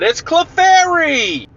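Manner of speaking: high, stretched cartoon announcer. TV reveals the answer to viewers, high drawn-out imitation. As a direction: sing out the answer like a TV show. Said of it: "and it said".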